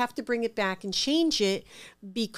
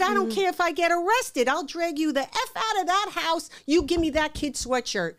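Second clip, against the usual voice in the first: retold threat; 7 semitones higher than usual. Banging on the door, threatening.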